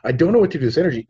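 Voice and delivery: fast, high, rushed mock frustration; quick hammed-up frustrated cry; mock frustrated outburst. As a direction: blurt it out fast like she's overwhelmed. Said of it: "She's mad".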